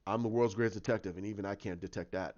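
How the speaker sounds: low, understated detective voice; low reply that softens at the end; Batman. Retold or imitated. Detective answering his butler, low and understated.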